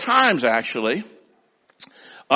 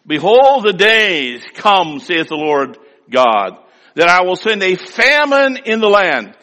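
scripture reading; 3 semitones higher, 5 dB louder than usual. Acted. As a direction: proclaim the verse to the congregation. Reads scripture in preaching voice.

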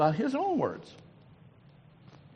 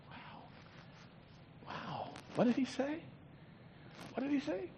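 awed hush; 9 semitones higher, 13 dB quieter than usual. Acted. Awed hush after list.

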